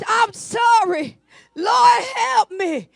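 loud, high, drawn-out mock crying to God; preacher acting out a crying prayer; imitated tearful plea. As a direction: wail it slowly like a penitent begging. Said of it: "You came to God crying".

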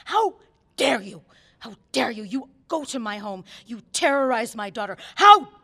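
furious outbursts, loud and high on each How; screaming. Acted. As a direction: let the rage break out. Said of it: "I scream".

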